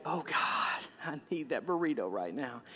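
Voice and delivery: hushed, breathy plea for a burrito; a small, quiet voice begging for food; mock whiny prayer. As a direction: whisper it like a guilty craving. Joking about craving food while fasting.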